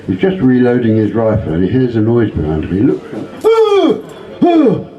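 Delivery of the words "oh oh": mock fright. Hunter spotting a huge grizzly bear, startled yelps. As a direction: yelp in sudden alarm.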